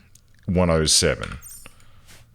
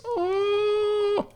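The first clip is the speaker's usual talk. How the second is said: squeaky high oh. Real, not acted. Reacting in game.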